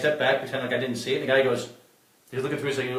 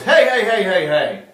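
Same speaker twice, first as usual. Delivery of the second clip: loud, higher calls to stop someone; calling out; 6 semitones higher, 9 dB louder, slower than usual. Retold or imitated. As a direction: call him back across the door. Bouncer calling him back.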